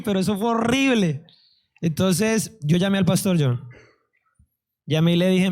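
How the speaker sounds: ordinary talk, nothing marked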